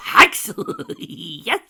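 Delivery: a squeaky cackle into the yes; cackling. Acted. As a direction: cackle with delight. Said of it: "cackled Conquest".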